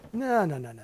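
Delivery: normal talking voice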